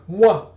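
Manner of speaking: high, loud "mwah" kiss noise; mock kissing sound; smacking kiss. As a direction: plant a big exaggerated smooch. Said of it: "plant one good smack".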